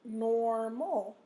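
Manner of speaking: "Normal" with a long, weighted NOR; stress on first syllable. Shown as a demonstration. Teacher showing where the stress is, "Normal" stressed on NOR. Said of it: "O R is in the first syllable".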